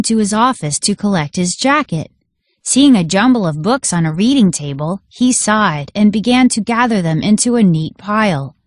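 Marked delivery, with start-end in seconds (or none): none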